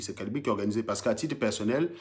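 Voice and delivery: ordinary talk, nothing marked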